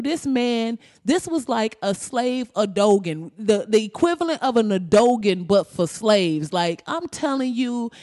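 usual speaking voice

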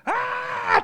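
sudden high yell as he charges; battle cry. Retold or imitated. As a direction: let out a wild charging scream. Soldier charges with bayonet.

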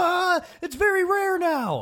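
very high slow falsetto. Retold or imitated. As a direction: squeal it up slowly. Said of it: "everyone's like".

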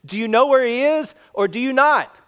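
high, loud, insistent demand; demanding question. Retold or imitated. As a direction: press him hard for a straight answer. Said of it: "the guy's asking him a straightforward question".

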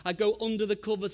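usual speaking voice

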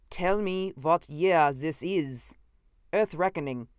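put-on slow, accented, emphatic demand; insistent. A narrator's reading. Visitor pressing his host, slowly insistent. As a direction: insist on it, word by word. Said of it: "he insisted".

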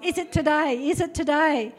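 lightly acted excited waiting; eager anticipation. Believer waiting eagerly for the Lord's return, mildly excited. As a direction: ask it with mild, eager expectancy. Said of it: "We should be excited".

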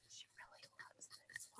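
whispered aside, barely audible; confidential whisper. Acted. Stylist tipping off family before the bride, whispering. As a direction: whisper it discreetly, out of the bride's hearing. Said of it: "you probably couldn't hear what I said".

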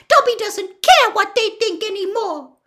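high, loud elf retort; defiant. Acted. Dobby shrugs off old masters, defiant. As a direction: squeak it out boldly. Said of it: "said Dobby defiantly".

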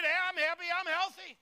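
high, loud happy declaration; I'm happy I'm healthy shouted high and loud; joyful shout. Shown as a demonstration. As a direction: belt it out for the congregation. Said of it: "Raise your hand. Just get".

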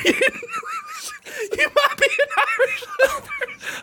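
very high, slow squeal through laughter; real laughter swallowing the punchline; helpless laughing. Real, not acted. Comic cracking up at his running joke, wheezing with laughter.

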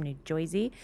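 mock New Jersey accent, "Jersey" as "Joisey"; put-on New Jersey "oi" in "Jersey". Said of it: "She talk like she's from Jersey?".

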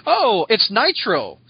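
ordinary talk

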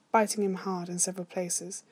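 usual speaking voice

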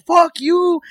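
sudden loud high curse; mock shout. Friend cursing at the whole team, loud and high. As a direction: spit the curse out at the group. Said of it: "and going".

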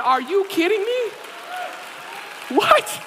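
mock-shocked yelp building up; stunned outburst. Husband stunned by extra bag.

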